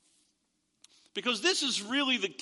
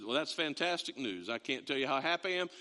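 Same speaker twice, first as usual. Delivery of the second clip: sarcasm; 7 semitones lower, 4 dB quieter than usual. Retold or imitated. Sarcastic reply to mechanic.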